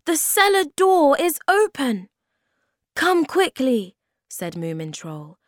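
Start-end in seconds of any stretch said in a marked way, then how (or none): 0.0-2.1 high, loud announcement; excited shout. A narrator's reading. Sniff tells friends of a way in, excited shout. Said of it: "shouted Sniff". 2.9-4.0 higher, louder call to hurry; rally the friends; urging. A narrator's reading. Moomin Troll hurries friends to the cellar, urging call.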